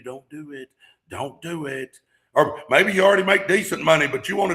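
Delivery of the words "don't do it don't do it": much softer coaxing fear voice. Retold or imitated. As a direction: murmur it like fear holding you back. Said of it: "fear is gonna be telling you".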